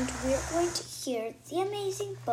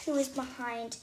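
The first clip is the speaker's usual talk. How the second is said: usual speaking voice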